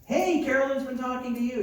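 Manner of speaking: lightly acted, high-pitched greeting; eager phone greeting.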